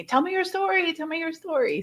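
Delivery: high mocking voice of people asking; mock request. Imitating people who ask about her story, high and mocking. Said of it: "I always have people asking me".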